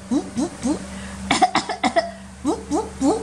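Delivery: coughing over puffing fart sounds; fake cough with fart noises. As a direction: mimic mom hiding farts with coughs. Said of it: "他就这样".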